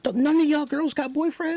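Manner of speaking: high-pitched mock disbelief; exasperated, squeaky-high challenge; incredulous questioning. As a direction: pitch it way up, baffled at these girls. Acting out own disbelieving reaction to characters.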